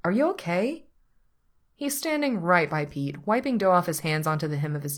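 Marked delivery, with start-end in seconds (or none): 0.0-0.9 higher-pitched concerned question; repeating himself. A narrator's reading. Patrick checks on Pete, repeating himself. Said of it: "in the tone that means he's repeating himself".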